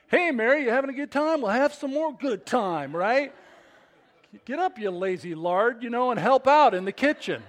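loud, high, sarcastic mock scolding; fed-up outburst. Preacher playing ticked Martha scolding Mary, loud fed-up outburst. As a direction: blow a fuse at a lazy sister, hammed up. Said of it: "She blew a fuse. She's ticked".

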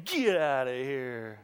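long drawn-out "Get", sliding down low; mock-kind dismissal. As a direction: slowly shoo them out, stretching the words. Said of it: "a very kind way of saying".

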